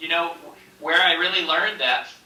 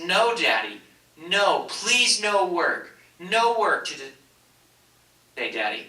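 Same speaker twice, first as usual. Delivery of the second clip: put-on higher, slowing childlike plea, fading on Daddy; imitating tearful toddler; 5 semitones higher, much slower than usual. Little girl begging her dad to stay home, tearful childlike plea. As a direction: beg Daddy not to go like a teary two-year-old. Toddler pleading in tears, retold.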